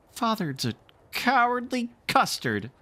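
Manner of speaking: put-on high, taunting child's voice; taunting boy. Michael taunts his father, high and jeering. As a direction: jeer at him like a kid.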